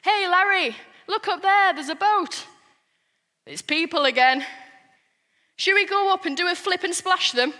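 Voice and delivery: high, loud cartoon dolphin; chatty dolphin. Dolphin spotting a boat with a friend, high chatty voice. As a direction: call to a pal as an eager dolphin. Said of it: "I wonder what dolphins talk about".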